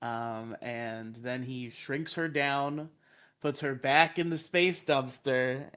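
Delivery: ordinary talk, nothing marked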